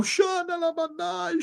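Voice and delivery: high, soft tongues babble; tongues while weeping. Retold or imitated. Preacher reenacting weeping tongues, high and soft. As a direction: murmur it through tears. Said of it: "E eu".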